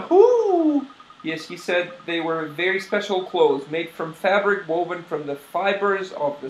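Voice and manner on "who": high, slow owl voice; owl-like old shopkeeper; hooting owl lady. Acted. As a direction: draw "who" out into a high hoot. Owl shopkeeper hoots on who.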